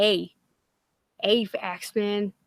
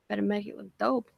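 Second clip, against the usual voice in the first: usual speaking voice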